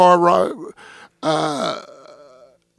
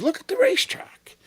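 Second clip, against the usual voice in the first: whisper; 12 semitones higher, 7 dB quieter than usual. Retold or imitated. Showing officials the tape.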